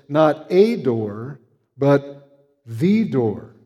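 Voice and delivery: not a door, but THE door, drawn out slowly; very slow, the word the weighted against a; stress on the. Shown as a demonstration. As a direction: land hard on the, stretched slow. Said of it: "that is further emphasized".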